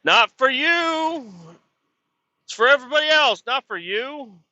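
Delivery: sing-song tease of the bosses; a mocking taunt voicing the bosses; mocking refusal.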